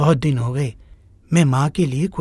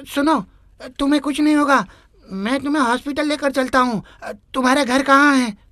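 urgent reassurance; 7 semitones higher than usual. Acted. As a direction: insist he'll live while rushing him. Driver urges dying boy.

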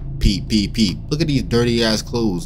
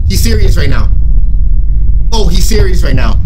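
loud, higher-pitched disbelief; alarmed; 4 semitones higher, 10 dB louder than usual. Real, not acted. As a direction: call it out loudly, rattled. Alarmed by the game's knocker.

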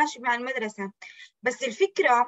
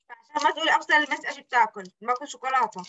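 usual speaking voice

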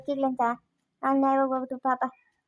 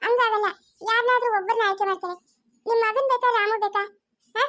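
higher, probing question; pandit testing; 6 semitones higher than usual. Acted. Pandit demanding the old man choose grandson or son, higher pressing voice. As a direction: press the hard choice on him.

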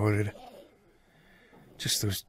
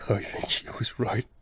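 barely voiced admission; hushed, whispered; whispered confession. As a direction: whisper it like it hurts. Confessing painful truth.

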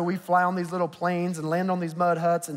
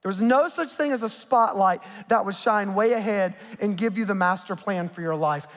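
usual speaking voice

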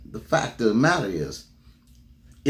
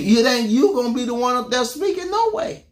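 incredulous; 7 semitones higher than usual. Real, not acted. Preacher mocking excuse.

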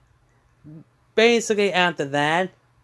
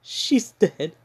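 stunned grief; 3 semitones higher than usual. Retold or imitated. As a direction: say it hollow and subdued. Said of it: "Ruby's confused".

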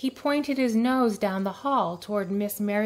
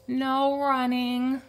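slow, high librarian call; stretched-out warning call; calling after; 4 semitones higher, slower than usual. A narrator's reading. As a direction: call a reminder after him. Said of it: "Miss Merriweather called after him".

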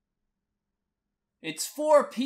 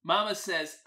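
usual speaking voice

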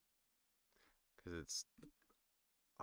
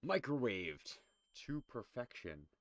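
high loud put-on announcer; 6 semitones higher, 4 dB louder than usual. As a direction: ham it like a chef ad. Joke voice about healing.

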